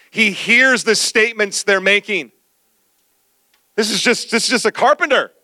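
rising sermon with the band playing; preaching. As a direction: preach it out, building, to the church.

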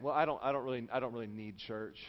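low, soft, evasive reply; acted half-hearted excuse; mock excuse-making. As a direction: mumble a sheepish justification. Acts out a churchgoer's excuse.

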